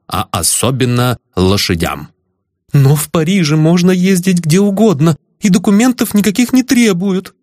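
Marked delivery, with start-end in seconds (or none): 2.7-7.3 high, timid pleading voice; babbling young lady. A narrator's reading. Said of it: "пролепетала несчастная".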